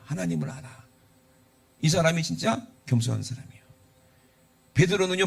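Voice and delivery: ordinary talk, nothing marked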